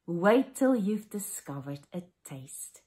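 ordinary talk

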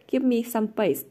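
loud, good-natured call to clear the way. A narrator's reading. Toby asking the girls to let him through, loud and good-natured. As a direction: cheerfully shoo the girls aside. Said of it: "good-naturedly".

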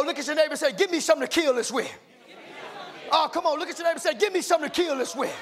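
rousing church preacher in full flow; fired-up preaching. Real, not acted. Preacher urging the church to become warriors, fired-up preaching. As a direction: preach it high and punchy, riding each phrase.

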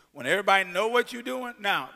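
ordinary talk, nothing marked

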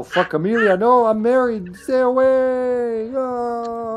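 high, loud mock panic, the last words drawn out slowly; hammed-up shriek of a married man fleeing a woman; mock panicked man. Pretending to flee character Amelia.

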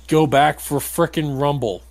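ordinary delivery

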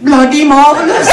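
high, loud mock delight; delighted dad. Dad spots a two-for-one at Sainsbury's, mock delight. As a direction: burst out as a thrilled dad, pitched right up.

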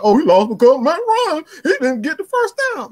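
lightly voiced whiny fan excuse; mocking a hater. Mocking the Matt Ryan haters, lightly mimicked excuse. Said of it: "Like, what are we talking about?".

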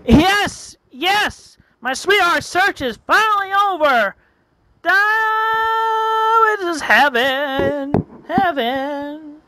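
high, elated cries with long held notes; ecstatic triumph. Acted. Lonely man whose sweetheart search is over, ecstatic triumph. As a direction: crow it high, stretching the big words.

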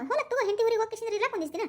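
ordinary talk

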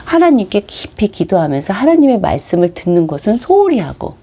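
usual speaking voice

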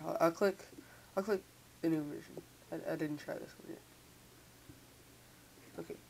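ordinary delivery

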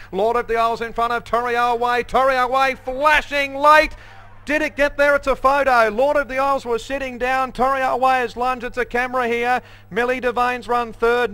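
high, loud photo finish call; excited finish call. Real, not acted. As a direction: shout the tight finish home.

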